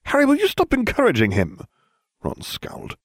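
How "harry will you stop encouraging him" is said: a high, sharp snap at him; snapped. A narrator's reading. Hermione scolding Harry for encouraging Ron, high snap. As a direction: bite the words off, fed up.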